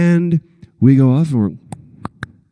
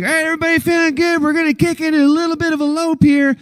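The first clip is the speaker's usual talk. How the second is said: much higher, fast cheery call to the riders; rally the riders like a cheerful coach; upbeat stunt coordinator; 13 semitones higher, faster than usual. Retold or imitated. Stunt coordinator calling to riders, cheery high. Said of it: "Las Vegas, is like".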